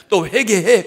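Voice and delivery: a loud, firm order to repent; stern command. Acted. God ordering Jeremiah to repent, loud and firm. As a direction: bark the command at him.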